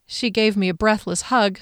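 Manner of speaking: ordinary delivery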